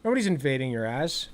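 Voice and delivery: ordinary talk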